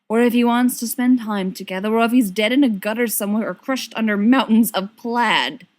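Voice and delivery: usual speaking voice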